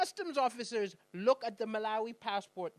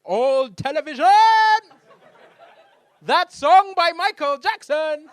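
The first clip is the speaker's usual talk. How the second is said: louder and louder, slowing at the end; building mock heckle; mock yelled suggestions; 5 semitones higher, 18 dB louder, slower than usual. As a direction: shout them out like better insults. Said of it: "he could have yelled out".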